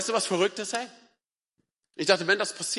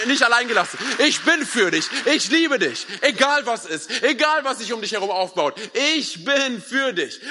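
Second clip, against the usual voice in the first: loud, high, emphatic proclamation; full-force preaching; 6 semitones higher, 5 dB louder than usual. Acted. Preacher proclaiming God's love to the congregation, full-force preaching. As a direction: drive home each promise, raised voice.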